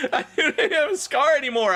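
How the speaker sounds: high shout with laughter; laughing shout. Acted. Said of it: "I shouted".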